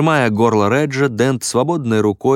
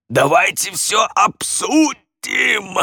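very high, slow, straining shout; strangled plea; 11 semitones higher, much slower than usual. Acted. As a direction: plead desperately while being choked. Редж умоляет, его душат.

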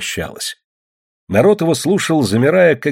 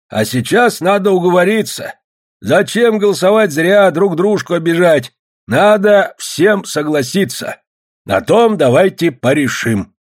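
raised, deliberate meeting voice; public speaker; 8 semitones higher, 4 dB louder than usual. A narrator's reading. Golovanov urges the meeting to agree, oratorical. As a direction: pitch it high to address a crowd.

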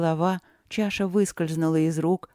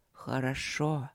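quiet; 4 semitones lower, 7 dB quieter, slower than usual. Acted. Voice approves trial.

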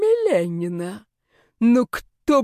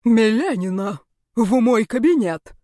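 raised, commanding summons; stern command; 3 semitones higher, 5 dB louder than usual. Acted. Rector summons the troublesome student to his office, stern command.

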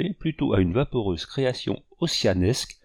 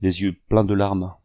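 ordinary delivery, nothing marked

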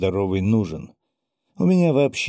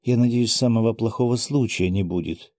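ordinary delivery, nothing marked

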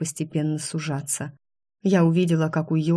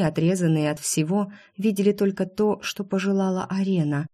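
plain reading voice

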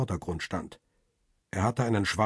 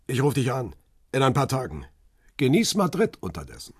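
raised, higher-pitched goodbye; parting call; 5 semitones higher, 5 dB louder than usual. A narrator's reading. Photographer taking leave of his visitor, raised parting call.

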